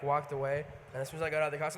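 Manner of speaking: ordinary delivery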